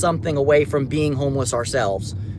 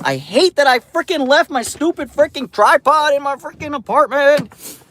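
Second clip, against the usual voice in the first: higher-pitched, frustrated venting; exasperated rant; 7 semitones higher, 3 dB louder than usual. Real, not acted. Streamer kicking himself over forgotten tripod, exasperated. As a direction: gripe at your own forgetfulness. Annoyed at forgetting his tripod.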